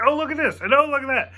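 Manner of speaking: imitating a gushing fan, pitched high; pointing out every detail like an eager fan; excited gushing. Imitating his excited friend, high eager gushing.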